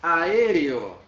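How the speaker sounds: aéreo said loud and drawn out; teacher showing aéreo; demo word. Shown as a demonstration. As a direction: announce aéreo loudly for the class. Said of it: "Olha aqui uma palavra".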